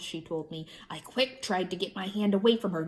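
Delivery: plain reading voice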